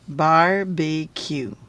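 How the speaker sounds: BARBECUE slowly, in three parts bar-bee-cue; syllable by syllable. Shown as a demonstration. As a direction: sound out BARBECUE for a young reader. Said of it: "I split it up into syllables".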